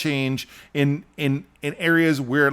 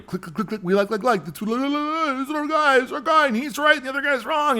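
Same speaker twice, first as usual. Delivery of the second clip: high, silly mimicry of gushing fans; mocking followers; 10 semitones higher than usual. Politician's followers clicking like, high mocking voice. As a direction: chatter in a high, mindless mock-cheer.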